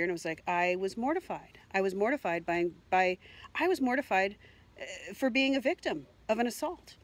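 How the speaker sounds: usual speaking voice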